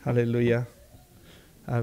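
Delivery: ordinary talk, nothing marked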